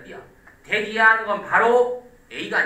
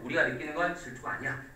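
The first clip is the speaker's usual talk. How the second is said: quick run-through; 4 semitones lower, 6 dB quieter, faster than usual. Shown as a demonstration. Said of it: "그럼 이제 이거 보세요".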